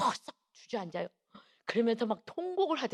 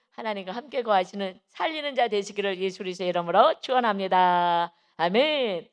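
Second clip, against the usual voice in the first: preaching blessing; 5 dB louder than usual. Acted. Preacher blessing the congregation, building to full voice. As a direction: build to a ringing amen.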